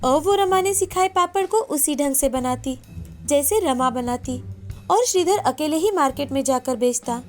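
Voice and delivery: plain reading voice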